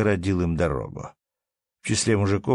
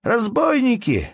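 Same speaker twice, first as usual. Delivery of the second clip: very high, loud cry of alarm; sudden wail; 5 dB louder than usual. A narrator's reading. Merchant cries out at the robbers, wailing. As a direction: raise the alarm at the top of your voice. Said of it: "завопил неожиданно купец".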